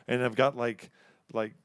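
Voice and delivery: ordinary delivery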